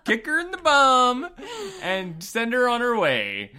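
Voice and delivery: put-on joking bark to kick the kid out; playful mock outburst. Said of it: "And I'm like".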